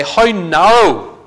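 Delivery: high, loud, indignant imitation of a critic; mock objection. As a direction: play the scandalised objector. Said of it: "some to object and say".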